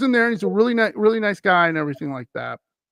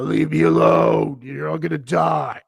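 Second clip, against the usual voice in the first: growling. Retold or imitated. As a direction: growl the threat from the throat. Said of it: "he keeps growling at 'em".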